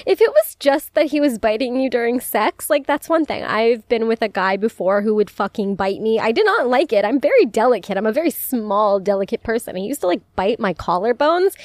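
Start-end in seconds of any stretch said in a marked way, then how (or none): none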